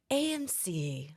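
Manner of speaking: very slow, hushed cinema-advert voice; soft, creepy drawn-out commercial delivery; Nicole Kidman. Shown as a demonstration. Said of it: "do your best Nicole Kidman".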